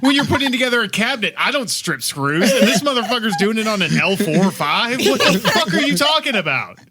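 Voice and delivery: high-pitched outrage breaking into laughter; incredulous rant. Real, not acted. Podcaster ranting at a surgeon's blunder, laughing outrage.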